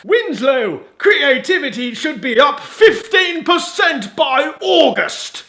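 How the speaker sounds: high, loud corporate boss demand; shouting boss. Acted.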